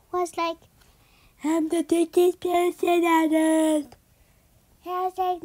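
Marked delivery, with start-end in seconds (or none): 1.4-3.9 put-on thick girl voice. Kid voicing thick girl.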